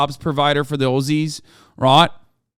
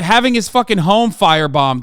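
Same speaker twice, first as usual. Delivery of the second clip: ordinary delivery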